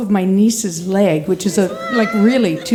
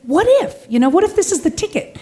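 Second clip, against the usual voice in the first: little inner voice; 5 semitones higher than usual. Retold or imitated. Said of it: "this little voice inside of me that says".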